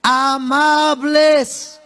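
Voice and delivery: Amables stretched very slowly and falling; preacher stretches Amables for emphasis; drawn-out key word. Shown as a demonstration. Preacher to congregation, stretching the key word slowly. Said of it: "¿Cómo tienen que ser?".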